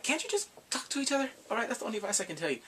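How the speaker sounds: rushed advice. Retold or imitated. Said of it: "and they're like".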